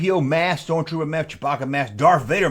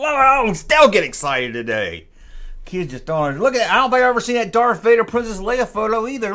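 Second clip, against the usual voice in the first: normal talking voice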